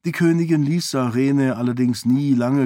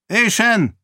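a sharp, loud call, much higher; gruff call; 9 semitones higher, 4 dB louder than usual. A narrator's reading. King calls his wife across the hall, gruff and loud. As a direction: bark the name across the hall. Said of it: "rief er unwirsch".

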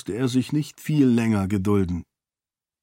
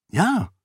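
a firm, higher "Ja" with weight; emphatic; 7 semitones higher than usual. A narrator's reading. Sarene insisting she will join the men, emphatic. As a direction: say "Ja" like it settles the matter. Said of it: "sagte Sarene mit Nachdruck".

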